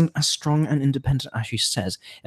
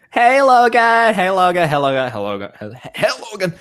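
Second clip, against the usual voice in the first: several friends' voices; 10 semitones higher, 7 dB louder than usual. Acted. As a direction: shift voice with every hello, as different friends. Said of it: "I had to do all the voices".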